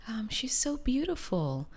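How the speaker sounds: high, hushed whisper of a gossiping lady; imitating a stranger. Wife imitating the lady in the store, high whispered gossip. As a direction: mimic her awed whisper. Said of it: "a lady next to me saying".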